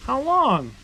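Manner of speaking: put-on high, loud childlike question; eager child voice. Ethan pressing his dad about the sap, eager childlike voice. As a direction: ask like an impatient little boy.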